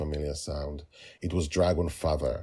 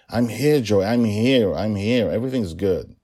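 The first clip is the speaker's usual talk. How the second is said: reassuring, a bit louder; father soothing his crying daughter; 5 semitones higher, 7 dB louder than usual. Acted. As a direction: comfort her as you hold her. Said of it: "His voice was soothing".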